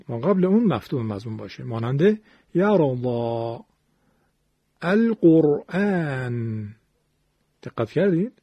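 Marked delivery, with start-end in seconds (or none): 2.5-6.7 slow, drawn-out یرا الله، القرآن with a thick ر; heavy R. Shown as a demonstration. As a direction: recite القرآن slowly, ر full and emphatic. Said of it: "اول موارد تفخیم را". (القرآن با رای درشت، شمرده و کشیده)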